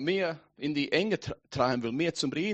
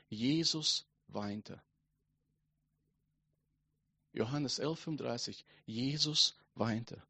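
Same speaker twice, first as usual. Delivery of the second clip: soft, solemn preaching with slow key words; hushed Bible reading; 3 semitones lower, 9 dB quieter, slower than usual. Acted. Preacher gives the congregation the shortest verse, hushed and solemn.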